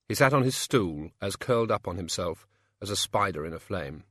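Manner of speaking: ordinary delivery, nothing marked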